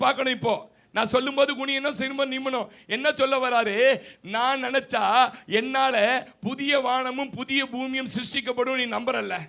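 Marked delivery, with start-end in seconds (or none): none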